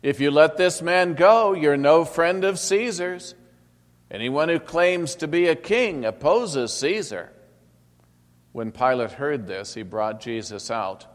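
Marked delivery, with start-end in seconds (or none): 0.0-7.3 loud, higher shouted threat; the mob threatening loudly; crowd shouting. Acted. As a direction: shout it as a warning to the governor.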